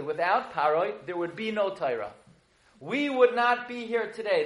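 usual speaking voice

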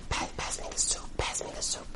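very soft acted whisper; whispered aside. Wife to her friend while husband speaks, whispering. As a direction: whisper it furtively under someone else's talk.

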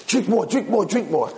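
high, repeated coaxing; acted insistent prompting; urgent urging. Old man urges more drink.

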